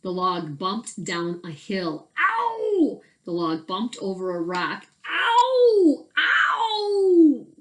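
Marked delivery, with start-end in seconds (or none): 2.2-3.0 high, loud cry of pain; hurt yelp inside the rolling log; sharp high squeal. Acted. Possum bumping in log. 5.1-7.5 high, loud cry of pain; hurt yelp inside the rolling log; sharp high squeal. Acted. Possum bumping in log.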